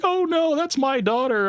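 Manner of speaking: high, delighted mock mom. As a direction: squeal it like a flattered mother. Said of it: "she'd be like".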